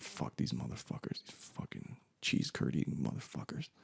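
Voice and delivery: whispered cursing under the breath; angry muttering. Retold or imitated. Angry at the border guards after getting in, muttered cursing. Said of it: "the whole time I'm angry".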